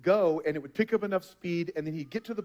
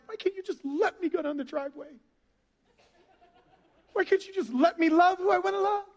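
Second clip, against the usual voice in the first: put-on higher, pleading teen complaint; pleading rebellious teen; 7 semitones higher than usual. Teenager pleading with God to let them go, mock whine. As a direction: beg like a teen fighting a parent's rules. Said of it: "we treat God like rebellious teenagers".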